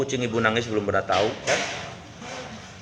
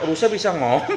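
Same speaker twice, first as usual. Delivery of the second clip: usual speaking voice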